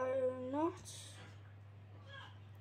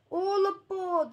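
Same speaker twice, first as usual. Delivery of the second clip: loud, higher call of All aboard; guard crying out; 5 semitones higher, 6 dB louder than usual. Acted. Train guard calling out to the children, loud call. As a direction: call everyone onto the train. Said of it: "the guard cried out".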